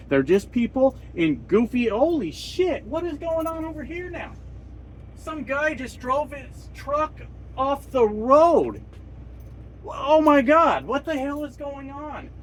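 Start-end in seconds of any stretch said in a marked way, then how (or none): 1.9-12.3 startled, higher exclamation; real surprise. Real, not acted. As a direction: suddenly caught off guard by the truck. Truck drives off road nearby.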